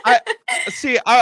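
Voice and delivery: laughing through the words. Real, not acted. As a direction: laugh while you speak. Laughing while answering.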